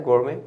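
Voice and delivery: "गोरमे" ending in an open "may" sound; silent T. Shown as a demonstration. Teacher repeating the correct reading for students, silent-t pronunciation. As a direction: drop the t at the end of "गोरमे". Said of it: "T silent होता है".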